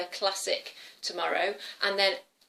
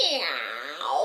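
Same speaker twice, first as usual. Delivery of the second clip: long swooping meow; cat meow; 5 semitones lower than usual. Pretend. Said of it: "Let's meow".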